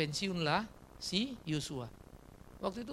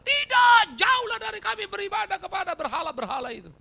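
high, loud defiant shout; crowd shouting; 13 semitones higher, 10 dB louder than usual. Acted. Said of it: "Orang Israel berteriak". (teriakan lantang orang Israel)

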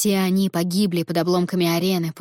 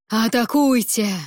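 slow, commanding war cry; rally the troops to attack; battle cry; 3 semitones higher, 3 dB louder, slower than usual. A narrator's reading. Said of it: "Боевой клич Неры".

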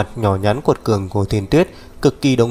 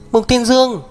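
shouting his name; 13 semitones higher than usual. Acted. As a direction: bellow his full name in outrage. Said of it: "Thiên Tuyết hô to".

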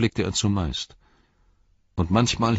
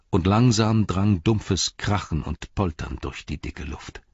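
ordinary delivery, nothing marked

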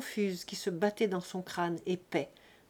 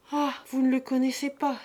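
crying out; 5 semitones higher, 7 dB louder than usual. Acted. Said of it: "s'écria Lise".

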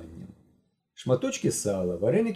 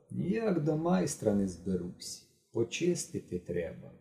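much softer, slow casual remark; offhand; 8 dB quieter, slower than usual. A narrator's reading. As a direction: show off without seeming to. Korovin shows off watch casually.

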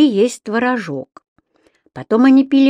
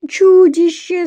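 put-on high, loud wailing little girl; tearful cry; 10 semitones higher, 6 dB louder, slower than usual. A narrator's reading. Еля telling her parents of a monster, tearful cry. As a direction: cry it out like a frightened child. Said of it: "заплакала Еля".

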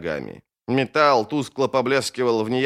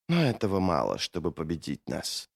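low, very soft, composed retort; subdued, controlled menace; quiet doubt; 7 semitones lower, 7 dB quieter than usual. Acted. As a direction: let it sink almost to nothing. Mamun coolly doubts the mage.

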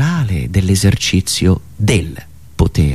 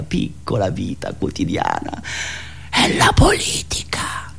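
soft, breathy aside whisper; 7 semitones lower, 7 dB quieter than usual. Acted. Comedian whispering the key word to the audience, hushed aside. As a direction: let the word slip out as a whisper. Punchline linking daily life, politics.

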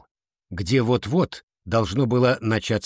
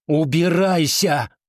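higher, drawn-out angry cry; angry yell; 6 semitones higher, 4 dB louder, slower than usual. A narrator's reading. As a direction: drive them away, stretching the word. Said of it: "заорала она". (сердитый протяжный крик)

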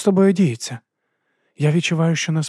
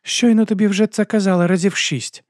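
higher, louder, harsh woman's retort; harsh reply; 10 semitones higher, 5 dB louder than usual. A narrator's reading. Starling answering the narrator harshly. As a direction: snap back at him. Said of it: "жорстко відповіла Старлінг".

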